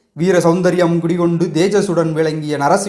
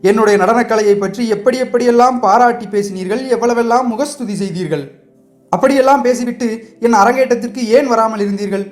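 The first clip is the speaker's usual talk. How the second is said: higher, heated accusing question; fiery demand; 6 semitones higher than usual. Acted. Sivakami turns on the prince, demanding why he missed her debut, fiery. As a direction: blaze at him for skipping her debut. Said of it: "கண்களில் கனல் பறக்க கேட்டாள்".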